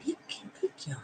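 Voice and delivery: hushed whisper, soft. Real, not acted. Owner whispers about the dog's yawn. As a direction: whisper so the sleepy dog stays calm. Hushed near sleepy dog.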